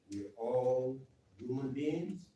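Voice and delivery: ordinary talk, nothing marked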